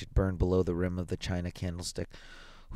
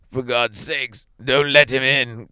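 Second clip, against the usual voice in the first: higher, louder frightened plea; trembling cry; 5 semitones higher, 9 dB louder, slower than usual. A narrator's reading. Old man pleading with his wife, trembling cry. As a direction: beg in terror, slowing down. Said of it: "cried the old man, trembling".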